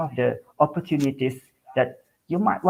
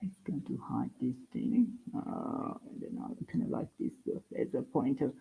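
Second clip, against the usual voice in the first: soft, half-whispered mumble under his breath; muttering to himself; 12 dB quieter than usual. Real, not acted. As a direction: talk to yourself while fiddling with the screen. Muttering to himself while screen-sharing.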